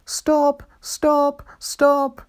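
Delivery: high wailing cries; high-pitched wailing plea; wailing coaches. A narrator's reading. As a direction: wail it like frightened carriages. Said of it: "wailed the coaches".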